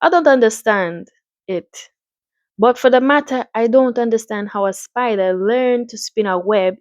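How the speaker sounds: put-on lilting Caribbean-accented doctor. Doctor pondering the spider's web, Caribbean accent. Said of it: "I'm gonna give him a Caribbean accent".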